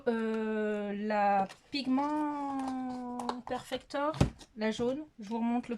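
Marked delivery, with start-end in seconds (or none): 1.7-4.1 slow, drawn-out English-accented product name; French speaker putting on English. Shown as a demonstration. Vlogger showing off a primer's name, English accent. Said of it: "avec mon accent anglais".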